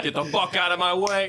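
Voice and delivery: gruff shout. Acted. Loud greeting outburst.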